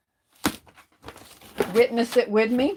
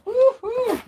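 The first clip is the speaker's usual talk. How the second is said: high squealing woo-hoo; excited whoop; 14 semitones higher than usual. Real, not acted. A vlogger cheering over her new bows, excited. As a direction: cheer with sheer delight. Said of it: "I'm so excited".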